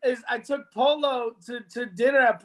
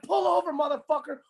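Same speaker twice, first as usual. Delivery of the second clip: high angry road-rage yell; 6 semitones higher than usual. Retold or imitated. Paul yelling at the driver who cut him off, angry. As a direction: bark it at the other driver.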